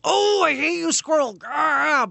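high, loud yapping dog voice; hammed-up furious dog; dog barking in words. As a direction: bark the words like an angry dog. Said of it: "barking thing".

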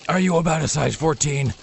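lightly voiced creepy caller. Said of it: "He sounded very creepy".

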